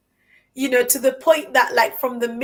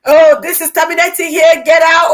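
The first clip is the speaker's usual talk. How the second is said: loud, higher mock dismissal of passengers; imitated curt bus driver; 5 semitones higher, 10 dB louder than usual. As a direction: order them off, imitating a rude driver. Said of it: "Usually the bus driver would be like".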